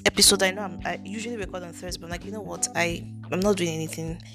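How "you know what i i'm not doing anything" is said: ordinary talk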